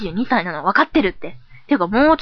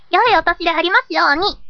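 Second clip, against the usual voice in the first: much higher, perky New Year wish; excited echo; 9 semitones higher than usual. Acted. Persona echoing the good-year wish, excited and high. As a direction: chime in with a high, eager voice.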